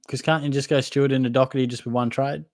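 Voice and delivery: ordinary talk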